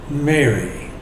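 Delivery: soft, low, falling "Mary"; tender naming. Acted. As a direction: say "Mary" gently, letting it settle. Risen Jesus calls her by name.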